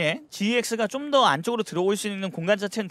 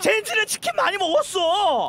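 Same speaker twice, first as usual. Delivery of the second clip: playful team voice; 8 semitones higher than usual. Pretend. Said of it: "지엑스와 보여줄 게는 얘기해야죠".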